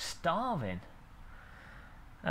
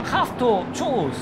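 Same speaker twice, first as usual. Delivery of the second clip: put-on slow, higher half-hearted German accent; a clumsy try at a German accent, slowly; bad German accent; 6 semitones higher, 3 dB louder, much slower than usual. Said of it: "I was trying German there".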